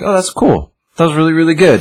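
imitating his own first reaction to the movie; mock enthusiasm. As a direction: play it up like someone easily wowed. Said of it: "the first one was like".

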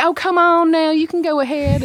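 easygoing Georgia. Acted. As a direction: wave someone ahead in a Georgia accent. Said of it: "we take our time in Georgia ... we say".

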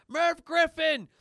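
high, slow, loud scream. Acted. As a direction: scream the name with all your lungs. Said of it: "they're screaming".

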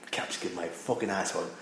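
muttered aside. Acted. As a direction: mutter it to yourself.

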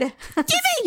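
high, grabby "give it"; greedy mock demand. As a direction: play a grabby, eager puppy-lover. Teasing friend's eagerness for puppy.

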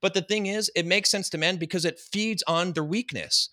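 ordinary talk

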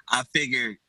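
ordinary talk

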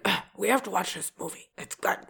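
soft, fast, bored grumble; grumpy boyfriend voice. Retold or imitated. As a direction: imitate him sounding fed up. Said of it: "my Chris impression".

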